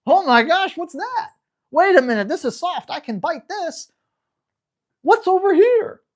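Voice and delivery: bouncy wide-eyed pup; curious puppy voice. Retold or imitated. Trainer voicing a carefree pup in new places, high and excited. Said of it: "puppy just walking around".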